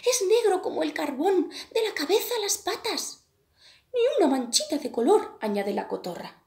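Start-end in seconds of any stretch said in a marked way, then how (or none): 0.1-5.4 much higher, louder chatter of small birds; gossiping birds. A narrator's reading. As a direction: voice the birds gossiping about him. Birds gossip about the crow.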